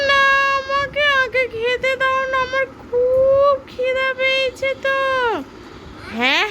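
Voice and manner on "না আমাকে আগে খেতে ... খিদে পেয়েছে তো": high, slow pleading doll; whiny doll voice. Pretend. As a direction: whine for food like a tiny doll. Toy friend whines for food. (উঁচু পুতুলের গলায় ঘ্যানঘ্যান)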